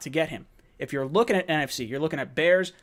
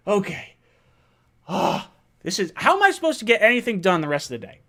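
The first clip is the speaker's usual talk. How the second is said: ordinary delivery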